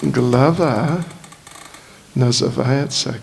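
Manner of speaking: usual speaking voice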